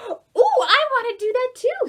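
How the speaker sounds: high, loud squeaky mouse voice; excited mouse. Acted. Storyteller voicing an eager mouse for children, high and loud. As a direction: squeal it like a thrilled little mouse. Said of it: "said the other mouse".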